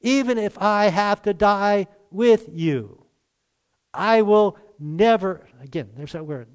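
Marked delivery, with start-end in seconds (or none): 0.0-5.5 slow, louder, higher declaration; emphatic vow. Retold or imitated. Peter vowing to Jesus, slow and emphatic. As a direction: swear it, slowly and firmly. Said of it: "declare it emphatically".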